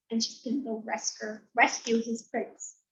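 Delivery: usual speaking voice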